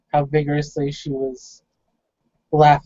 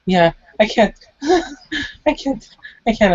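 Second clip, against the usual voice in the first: giggling mid-sentence, words breaking off; acted giggly stammer, pitched up in bursts; through giggles; 7 semitones higher than usual. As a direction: try to speak but keep giggling. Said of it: "Through her giggles".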